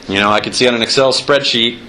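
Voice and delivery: ordinary talk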